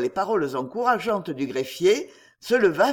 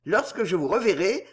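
usual speaking voice

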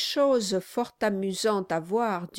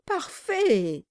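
higher, gushing exclamation; fawning cry; 8 semitones higher than usual. Acted. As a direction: flatter him eagerly. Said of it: "cria-t-il".

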